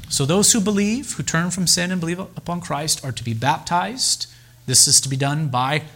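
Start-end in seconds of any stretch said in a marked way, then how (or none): none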